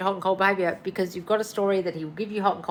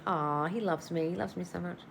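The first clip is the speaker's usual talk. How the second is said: dreamy soft gush; 9 dB quieter than usual. Retold or imitated. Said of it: "he's saying".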